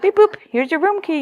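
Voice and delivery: mock automatic customer-service chirp; mindless receptionist patter.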